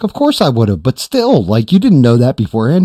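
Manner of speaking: high, loud protest; incredulous and emphatic; retold exasperation. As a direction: act out an exasperated objection, voice jumping up. Retelling his exasperated reply.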